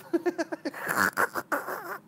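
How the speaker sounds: high breathy laugh; helpless laughter. Real, not acted. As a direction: lose it laughing. Laughing fit. (fou rire)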